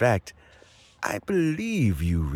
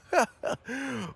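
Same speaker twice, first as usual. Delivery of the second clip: warm chuckle. Acted. Old man greeting guests with a delighted chuckle.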